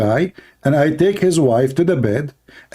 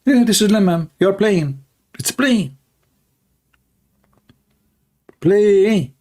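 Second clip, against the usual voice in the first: lightly raised mock breezy tone; mocking imitation; 6 semitones higher, slower than usual. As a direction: sneer at the excuse. Mocking the excuse as mere play.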